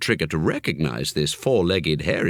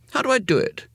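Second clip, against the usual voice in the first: put-on eager boy; faster than usual. A narrator's reading. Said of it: "he said".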